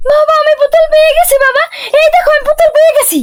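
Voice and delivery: high, fast, urgent cry; excited calling out. Acted. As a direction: shout the discovery to your father. Child calls out having found doll.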